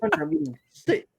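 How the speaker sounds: high, insistent no no no; mock exasperated refusal; exasperated protest. Protest repeated, higher.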